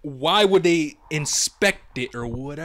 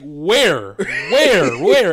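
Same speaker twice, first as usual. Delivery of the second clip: frantic where; 5 semitones higher, 8 dB louder, much slower than usual. Retold or imitated. Searching the box, retold.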